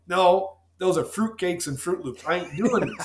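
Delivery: mocking scoff. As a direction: sneer at the raw milk crowd. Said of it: "I was like".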